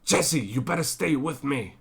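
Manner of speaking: a clumsy go at Walt's insistence; Walt impression. Vlogger doing a bad Walt telling his partner to stay. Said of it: "Sorry, that was not good".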